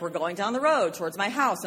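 ordinary delivery

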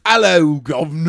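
loud mock-British greeting; put-on British accent. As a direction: burst out with a silly British hello. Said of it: "Would you do the other voice".

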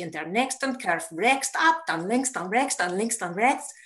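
clipped German sat-nav voice; German satnav directions. Retold or imitated.

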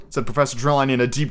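ordinary talk

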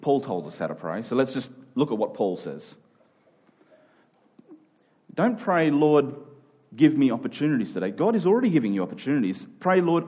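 usual speaking voice

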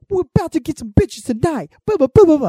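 high, loud hammed-up rapper boast; mocking rapper voice. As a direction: ham up a cocky old rapper.

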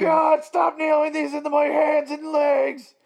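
very high, loud pleading shriek; over-the-top high victim's wail; mock agony scream. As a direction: scream as if being nailed up, hammed-up. Acts out person being nailed.

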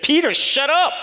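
put-on high, loud yell at the boy; angry yell. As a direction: bark it like an angry father. Father yelling at the boy.

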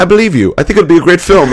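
high, loud imitated British accent; Indian British guy. British financier backing the film pitch, high imitated British accent. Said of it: "British guy, and he goes, like".

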